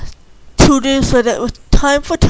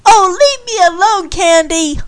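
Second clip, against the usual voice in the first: annoyed holler, pitched up from the narration; 8 semitones higher than usual. A narrator's reading. Tom telling Candy to leave him alone, hollered. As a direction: holler it back, higher, to shake her off. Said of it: "he hollered back".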